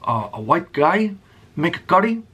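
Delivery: put-on a half-faded Indian accent, quick and buttering up; a light Indian accent, boss flattering his employee; Hardy's Indian accent. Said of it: "I can't even do the accent anymore".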